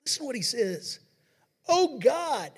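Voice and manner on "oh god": loud, higher cry of Oh God; exclaimed. Shown as a demonstration. Reading the psalm to the church, crying out loud. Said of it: "that's with an exclamation point".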